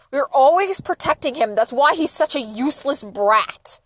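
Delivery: loud, drawn-out angry accusation; angry father. A narrator's reading. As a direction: lay into them loudly as a furious dad. Father rages at mother in story.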